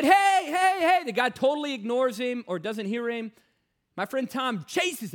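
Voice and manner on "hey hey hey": loud, high call of hey hey hey. Retold or imitated. Tom calling after the smoking stranger, loud and high. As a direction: shout after someone walking away.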